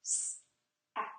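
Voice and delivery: "Sat" said sound by sound, whispered; segmented word. Shown as a demonstration. Teacher leading students to blend the word, whispered. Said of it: "everybody say it with me".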